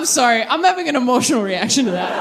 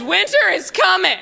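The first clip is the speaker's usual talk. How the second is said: mock epic proclamation; 6 semitones higher than usual. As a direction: herald doom playfully. Game of Thrones quote, shouted.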